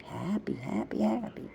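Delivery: breathy high whisper of happy, happy, happy. Shown as a demonstration. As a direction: whisper each happy, rising. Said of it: "Don't you like the way I say happy?".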